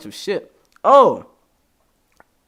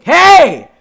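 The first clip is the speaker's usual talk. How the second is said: very high, loud, slow shout; very high excited shout; mock listener greeting; 18 dB louder, much slower than usual. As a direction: shout it like an eager fan. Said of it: "text me back".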